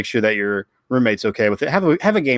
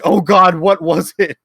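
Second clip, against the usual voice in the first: higher, louder worried gasp; a flustered oh no; alarmed wife; 7 semitones higher, 6 dB louder, slower than usual. Retold or imitated. Wife realising she sent a recording, alarmed.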